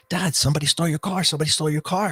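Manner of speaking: lightly acted excited kid; urgent son. Startled son wakes him, retold.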